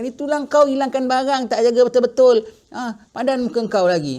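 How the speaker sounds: a hint of a nagging mistress; scolding. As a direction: scold the careless child. Scolding the servant child.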